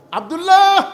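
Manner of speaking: loud, high call of the name; a father hollering his son's name at night. Acted. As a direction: shout the name through the door. Father calling out at door.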